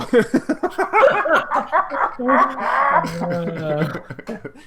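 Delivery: long, high laughing fit; helpless high cackling laugh; uncontrolled laughter. Real, not acted. Breaks up at running joke.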